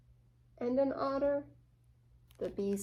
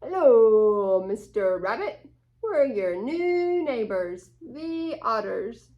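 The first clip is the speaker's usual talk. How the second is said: louder, bright greeting from new neighbors; cheery otters; 11 dB louder than usual. Acted. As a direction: call out a friendly hello. Reader voices the otters greeting.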